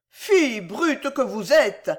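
high, drawn-out female rebuke; crying out indignantly. A narrator's reading. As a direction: shame the brute. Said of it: "s'écria la compatissante hôtesse".

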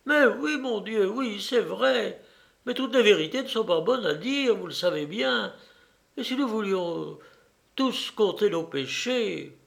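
protesting aunt, high and sing-song; flustered aunt. Acted. Voices the aunt in dialogue.

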